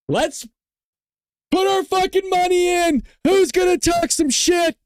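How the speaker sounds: high, loud and fired up; rally the chat like a fight announcer; hyped up. Real, not acted. Hyped call to start the stream.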